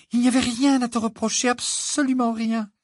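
very high, thin character voice; high-pitched emotional reassurance; overwrought girl. Acted. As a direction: speak high, as if overcome with relief. Hermione relieved after near-fainting anguish.

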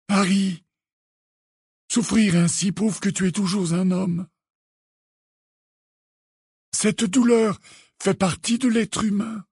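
put-on high, gentle old headmaster's voice; patient consolation, evenly paced; calm. A narrator's reading. Dumbledore explaining suffering to Harry, calm. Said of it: "de la même voix calme".

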